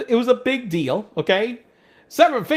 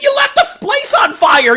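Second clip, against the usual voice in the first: loud, much higher shout with a laugh; shouted blame; 7 dB louder than usual. Retold or imitated. Scolding the careless coworker, loud laughing shout. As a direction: yell it at the idiot, half laughing.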